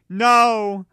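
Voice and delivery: high loud frightened cry; scared shout. A narrator's reading. Waking from a nightmare, scared high shout. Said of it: "I shouted in a scared voice".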